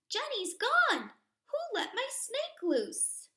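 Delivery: high, loud panicked yell; shouting. Acted. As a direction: scream it across the classroom. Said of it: "Emily shouts".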